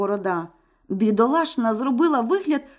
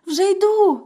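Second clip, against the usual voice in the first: high, louder hurried reply; wife stalling the villain; frightened wife; 9 semitones higher, 5 dB louder than usual. Acted. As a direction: call back quickly to stall. Wife answers from room.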